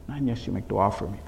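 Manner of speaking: low, soft repeated request; hushed plea. Retold or imitated. Messenger asking Imam for dua, in a hushed plea. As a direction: drop to a quiet, humble appeal. Same condition repeated softly.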